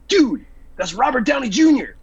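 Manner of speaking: high-pitched, incredulous retold exclamation; aghast disbelief. Buddy revealing the stranger's identity, aghast. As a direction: blurt the reveal like an appalled friend.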